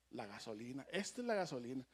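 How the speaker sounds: dropped way down, almost under the breath; hushed nickname. Retold or imitated. Speaker to congregation, mock-revealing a nickname, hushed. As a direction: murmur the nickname like a secret.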